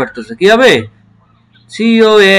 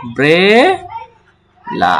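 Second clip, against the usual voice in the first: Umbrella stretched very slowly with a long final আ; slow model pronunciation; 2 semitones higher, much slower than usual. Shown as a demonstration. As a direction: draw out umbrella for students to copy. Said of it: "তাহলে কি দাঁড়াচ্ছে?". (খুব ধীরে টেনে উচ্চারণ)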